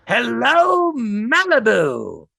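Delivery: high, drawn-out showman hello; grand greeting. Retold or imitated. Naked friend greeting the beach, slow showman hello. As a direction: announce yourself to the whole beach. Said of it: "completely nude and says".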